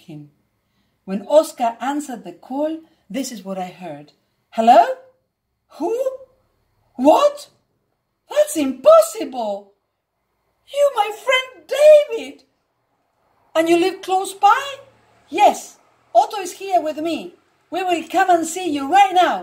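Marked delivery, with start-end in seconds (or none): 4.5-14.6 excited surprise. Retold or imitated. Stunned friend on phone call. 15.4-19.4 excited. Retold or imitated. Eager reply on the phone.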